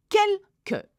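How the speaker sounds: teacher's high-pitched model of "Quelques"; syllable-split demo. Shown as a demonstration. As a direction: break "Quelques" into two clear beats, k sound only. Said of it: "Q U in French is not que".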